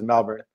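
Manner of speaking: Melbourne said MEL-burn, with a short, light second syllable; happy-medium pronunciation. Shown as a demonstration. Expat giving his happy medium, Melbourne as MEL-bern. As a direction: say Melbourne as MEL-bern, between "born" and "bin". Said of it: "have a little happy medium".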